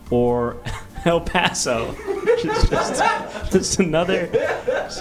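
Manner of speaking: laughing through the words. Real, not acted. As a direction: break up laughing mid-list. Cracks up listing locations.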